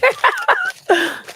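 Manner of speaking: high burst of laughter; real laugh. Real, not acted. Co-host after her husband threw something at her, laughing. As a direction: break up laughing, pitch well up.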